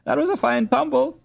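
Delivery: a little sister teasing her fallen brother; teasing little girl. A narrator's reading. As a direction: poke fun at the tumble in a girl's voice.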